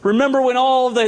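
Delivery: normal talking voice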